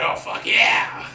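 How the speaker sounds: high drawn-out whooped yeah; excited whoop. Retold or imitated. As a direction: whoop it out with glee. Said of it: "he's like".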